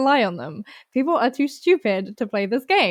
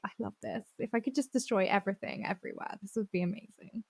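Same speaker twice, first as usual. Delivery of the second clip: quiet retold glee; 9 dB quieter than usual. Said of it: "and I'm like".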